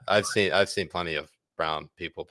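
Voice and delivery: ordinary delivery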